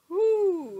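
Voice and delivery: high, loud, drawn-out whoop; long cartoon holler; Goofy. Shown as a demonstration. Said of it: "do your best Goofy voice".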